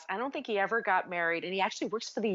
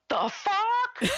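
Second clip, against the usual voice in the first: high, louder retold disbelief; shocked outburst; 14 semitones higher, 3 dB louder than usual. Woman recalling being tagged in an old photo, shocked. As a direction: blurt it in mock-appalled shock. Retelling her outraged reaction to tag.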